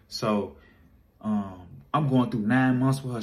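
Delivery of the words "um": normal talking voice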